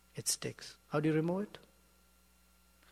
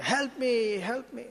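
high-pitched acted distress call; imitated plea for help, pitched well up; crying out for help; 10 semitones higher, 4 dB louder, slower than usual. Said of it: "he's crying out".

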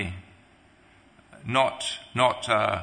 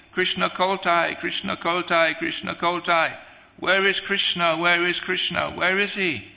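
high, repetitive retold lament; acted desperate cry, without real tears; imitated crying plea; 6 semitones higher than usual. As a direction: call out longingly, as if searching for Krishna. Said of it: "he's always crying".

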